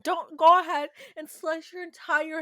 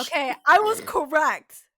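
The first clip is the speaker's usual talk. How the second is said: much louder, gloating that you won; loud vindication; 9 dB louder than usual. Real, not acted. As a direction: crow it loudly, triumphant. Vindicated after accent argument.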